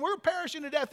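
mock panic. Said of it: "they're thinking".

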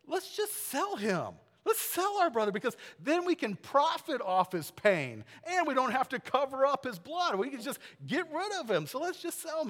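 high-pitched, wheedling sales pitch; eager schemer. Acted. Judah persuading his brothers to sell Joseph, eager scheming.

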